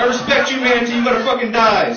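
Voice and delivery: loud, high mock solemn hunter; mock reverent hunter. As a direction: declare it like a proud hunter. Hunter voiced again.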